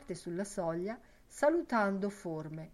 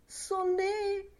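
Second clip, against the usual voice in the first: high-pitched held-out call; urging cry; 13 semitones higher than usual. A narrator's reading. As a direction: call it out high to egg her on. The urging cry comes back. (grido acuto d'incitamento)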